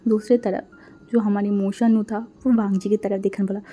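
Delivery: usual speaking voice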